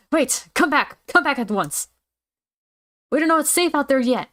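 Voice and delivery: high, urgent command; alarmed call. Acted. Calling back a student who is leaving, alarmed. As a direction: call after them in high-pitched alarm.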